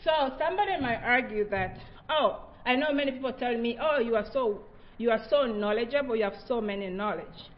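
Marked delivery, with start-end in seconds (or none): none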